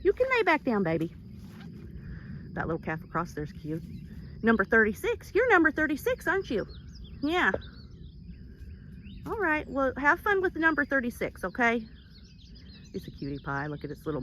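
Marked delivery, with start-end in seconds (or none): 0.0-1.1 high cooing voice. Real, not acted. Owner soothing her donkey in high baby talk. 5.3-11.8 high, cooing pet voice; pet talk to a calf. Real, not acted. Owner cooing at a neighbour's calf in pet talk.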